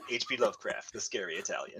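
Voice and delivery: normal talking voice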